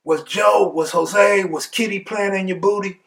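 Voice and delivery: high-pitched teasing list of names; taunting. Retold or imitated. Cellmate keeps needling Lyle, mocking taunt.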